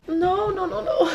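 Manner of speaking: high, pleading no no no; panicked no. Real, not acted. Reader dreading the next page, high panicked pleading. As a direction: beg the book not to do it. Reacting to a tense book scene.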